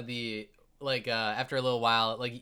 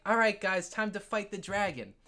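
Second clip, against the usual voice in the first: put-on kid voice; 6 semitones higher than usual. Kid rallies the others.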